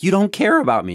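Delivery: high, loud, hurt complaint of an aging parent; a hint of a guilt-tripping parent; mock wounded parent; whiny accusation.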